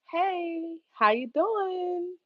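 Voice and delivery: drawn-out, slow fake-friendly hey; sweetly sarcastic, slow; sarcastic greeting. To the manager checking in, slow mock-friendly greeting. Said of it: "I'm, like, being sarcastic".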